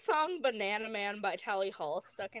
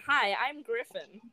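normal talking voice

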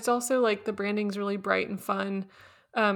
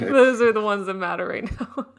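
laughing; 7 dB louder than usual. Real, not acted. Laughing while making a joke.

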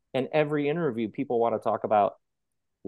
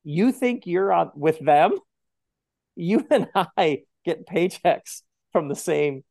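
higher, slow, indignant put-down; indignant retort; 5 semitones higher, 4 dB louder, slower than usual. Retold or imitated. Host voicing a character scolding a colleague, indignant and slow. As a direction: pull her up short, slowly and pointedly. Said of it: "she's like".

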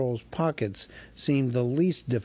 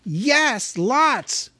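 frightened hobbit; 10 semitones higher, 5 dB louder than usual. A narrator's reading. Bilbo blurts.

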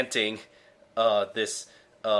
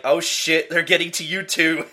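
higher, louder alarmed cry; 6 semitones higher, 6 dB louder than usual. Retold or imitated. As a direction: react like you've seen it spread. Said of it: "And he's like".

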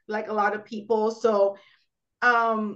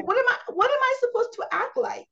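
exasperated inner rant; 8 semitones higher than usual. Retold or imitated. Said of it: "but I'm like".

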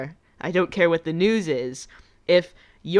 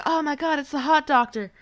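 gushing; 8 semitones higher than usual. Retold or imitated. Everyone gushing over Carlisle.

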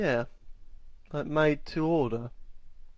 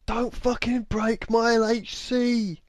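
slow, higher-pitched mock tirade; mock angry shouting; 8 semitones higher than usual. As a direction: play an irate film star telling off the crew. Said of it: "like, start shouting at people".

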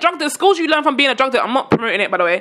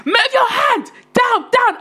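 shouted command; 9 semitones higher, much slower than usual. Retold or imitated. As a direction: yell directions at the model. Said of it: "so if I'm telling you".

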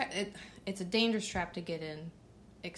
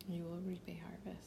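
whispering; 8 dB quieter than usual. Real, not acted. Quiet aside reading scripture.

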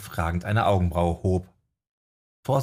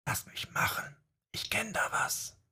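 voiceless, very soft secret whisper; careful whisper; 14 semitones higher, 11 dB quieter than usual. A narrator's reading. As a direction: whisper it into her hair, conspiratorial. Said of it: "Genauso vorsichtig flüsterte er".

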